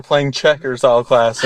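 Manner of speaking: ordinary talk, nothing marked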